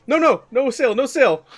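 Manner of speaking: loud, frantic no sale; frightened vendor shouting no; panicked refusal. Acted.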